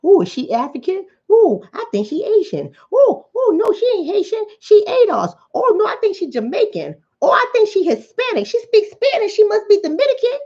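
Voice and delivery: high-pitched mock gossip with excited ooh. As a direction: mimic nosy people guessing her background. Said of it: "We like to try to figure out what Doctor Shauna is".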